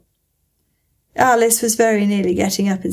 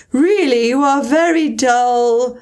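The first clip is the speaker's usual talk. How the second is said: louder, cross and scornful; angrily; 5 semitones higher, 4 dB louder, slower than usual. Acted. Mock Turtle scolds Alice for her question, angry. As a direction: scold her for being slow. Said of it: "said the Mock Turtle angrily".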